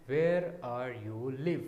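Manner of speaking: very slow word by word; slow gloss. Shown as a demonstration. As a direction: spell out the gloss slowly. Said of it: "इसका मतलब हुआ". (धीमे-धीमे अर्थ बताना)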